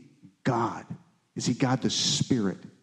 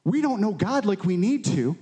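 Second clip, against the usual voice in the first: higher, insistent retold exhortation; lightly voiced John the Baptist; 6 semitones higher than usual. Prophet telling people to admit their failing, mildly insistent. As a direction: urge them in a raised preaching voice. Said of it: "He's like".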